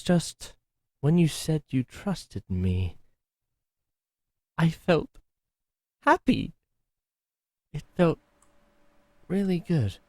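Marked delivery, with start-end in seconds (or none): 4.6-10.0 higher and louder on happy, voice lifting; a shy confession that lifts high; touched happiness. Acted. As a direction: let happy break upward. Confessing happiness after dark story.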